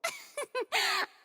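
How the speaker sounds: high, knowing theatrical laugh; haughty laugh. Acted. Furina laughs before naming the culprit, haughty laugh. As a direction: laugh like you've solved it already.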